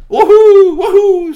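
very high, very loud victory whoop; Grassy whooping in triumph; whooping cheer. Acted. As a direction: cheer wildly at your own throw. Grassy cheers his throw.

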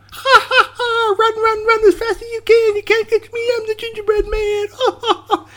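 very high giggly taunting chant; laughing gingerbread man. Acted. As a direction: squeal the taunt through laughter. Gingerbread man taunts while escaping.

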